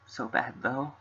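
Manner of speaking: quiet, gentle reading of game dialogue; soft character reading. Acted. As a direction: read the lines softly, in character. Reading visual novel dialogue.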